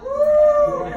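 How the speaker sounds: high, loud victory whoop; real whoop of joy; excited whoop. Real, not acted. Celebrates another loyal.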